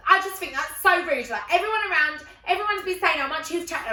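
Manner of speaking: high, loud nagging reproach; indignant scolding. Retold or imitated. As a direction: press the telling-off on a friend. Friend keeps scolding her.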